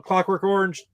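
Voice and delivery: ordinary talk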